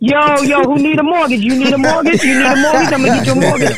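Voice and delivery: Uncle Nick's pitch. Retold or imitated. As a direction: sell it like a pushy loan guy hawking mortgages. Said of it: "he up there trying to say".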